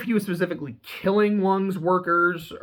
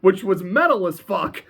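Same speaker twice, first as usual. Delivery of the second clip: usual speaking voice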